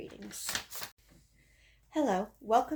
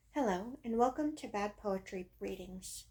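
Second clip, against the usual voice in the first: ordinary delivery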